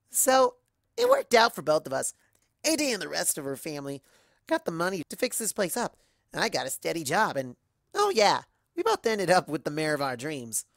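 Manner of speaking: lively boastful pony, starting high and loud; pleased with how things turned out; upbeat Rainbow Dash. Acted. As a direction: burst back in cheerfully, then settle into chat. Rainbow Dash sums up happily.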